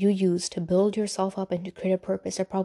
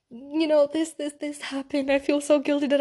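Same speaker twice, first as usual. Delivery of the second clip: high, tearful replay of a voice note; reliving the sob, but only a mock version; retold crying; 7 semitones higher than usual. Telling viewers about her crying voice message, high and tearful. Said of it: "I was bawling my eyes out".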